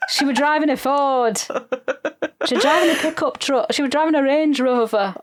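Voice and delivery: hammed-up Northern English Sean Bean voice; mock northern accent. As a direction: play a gruff Yorkshireman searching for his family. Mocking Sean Bean's northern accent.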